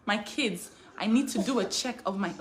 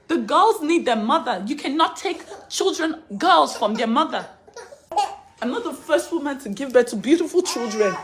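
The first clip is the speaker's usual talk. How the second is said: loud, desperate cry of protest; real anguished outcry; 3 semitones higher, 7 dB louder than usual. Real, not acted. Mother pleading over her children.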